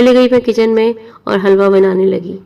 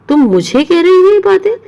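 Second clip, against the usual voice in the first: high, louder indignant retort; outraged aunt; 8 semitones higher, 4 dB louder than usual. Retold or imitated. Aunt snapping back at her niece, high and indignant. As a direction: fire it back, stung and offended.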